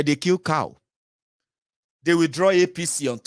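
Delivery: ordinary talk, nothing marked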